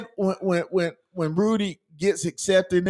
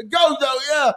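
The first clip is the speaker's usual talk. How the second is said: cheering; 7 semitones higher than usual. Real, not acted. Cheering for his team.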